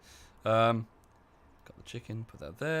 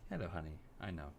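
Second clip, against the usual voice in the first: soft pet talk; 5 semitones lower, 11 dB quieter than usual. Real, not acted. Talking softly to the dog.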